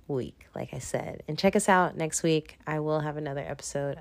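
ordinary talk

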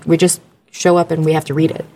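usual speaking voice

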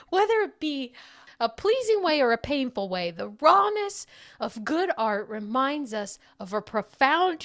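put-on loud, over-the-top dude accent; shouting capitalized words. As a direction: shout it like an all-caps surfer review. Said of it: "gonna read it in all caps... put a little accent on it".

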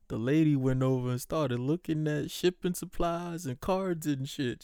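ordinary talk

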